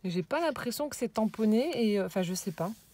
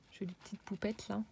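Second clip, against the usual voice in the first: whisper; 7 dB quieter than usual. Real, not acted. Admiring a craft detail aloud.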